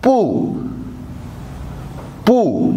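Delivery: पू with a sharp falling fourth tone; पू spoken twice, falling each time, as a model; fourth tone demo. Shown as a demonstration. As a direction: drop पू hard from the top. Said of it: "वो fourth होती है".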